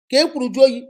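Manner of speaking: loud mocking plea; mock apology. Mocking cheating husband, loud plea. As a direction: parody the repeated apology.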